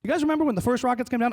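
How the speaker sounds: ordinary talk, nothing marked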